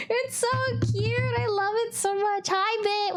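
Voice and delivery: high, gushing delight. Real, not acted. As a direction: gush over it sweetly.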